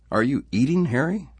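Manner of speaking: high, loud, rising taunt; needling question. Acted. Workman to tired Harry, high and needling. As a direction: needle him with a pointed question.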